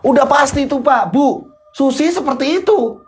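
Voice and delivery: high, insistent complaint that keeps climbing; heated accusation. Acted. Husband accusing wife before her parents, high and heated. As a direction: press the charge with aggrieved certainty.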